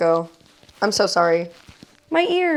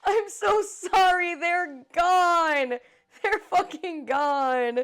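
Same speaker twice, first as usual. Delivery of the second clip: high, loud, slow, half-laughing wail; laughing despair; 8 semitones higher, 5 dB louder, much slower than usual. Real, not acted. Streamer laughing and wailing to chat over the lost team. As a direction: mourn it through laughter.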